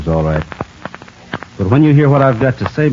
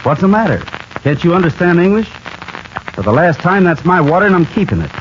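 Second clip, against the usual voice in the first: higher, impatient snap, defensive and cold; sharp rebuke; 8 semitones higher than usual. Acted. Gene snapping at Johnny to keep his water, impatient and sharp. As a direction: slam the door on the argument, pitched up.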